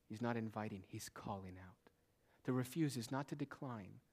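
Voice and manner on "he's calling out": hushed, slow whisper; soft slow whisper. Acted. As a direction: drop to a breathy whisper for effect. Quiet aside in the sermon.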